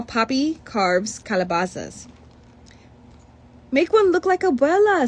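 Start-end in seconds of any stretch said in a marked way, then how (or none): 3.7-5.1 higher and louder, bubbling with an idea; a kid asking dad eagerly, voice up high; eager child. Acted. Beto asking Papi for an Abuela pumpkin, eager higher child voice. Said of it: "says Beto".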